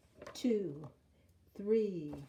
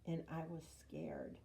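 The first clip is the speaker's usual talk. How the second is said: plain reading voice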